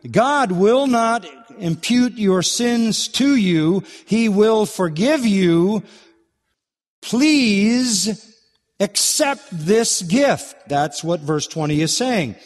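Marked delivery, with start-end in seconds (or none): 0.1-10.5 high, drawn-out pleading; earnest appeal. Acted. Preacher delivering God's appeal to alienated subjects, earnest pleading. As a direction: appeal to them slowly, pitched high. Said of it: "is making an appeal".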